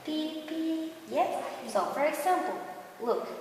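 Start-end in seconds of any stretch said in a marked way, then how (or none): 0.1-0.9 high horn beeps; teacher's playful horn sound. Shown as a demonstration. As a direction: model the car horn for kids. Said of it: "Can you do it?".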